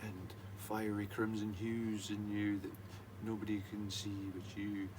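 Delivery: slow, soft, soothing guru voice; hypnotic motivational voice. Pretend. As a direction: lull them like a hypnotist. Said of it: "[name]'s motivational speaking on [name]'s hypnosis".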